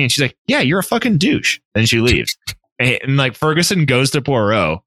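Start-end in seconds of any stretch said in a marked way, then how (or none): 0.5-1.6 higher, acted-out put-down; mock insulting retort. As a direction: throw the insult back in a high voice. Acted quarrel, rejecting a suitor.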